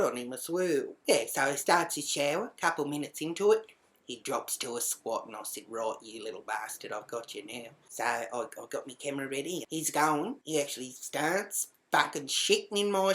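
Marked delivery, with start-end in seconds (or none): 5.7-7.7 low, hushed threat to oneself; gloating to self. Retold or imitated. Spying on the housemate through the bathroom window, low gloating. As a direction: keep it down while catching them out.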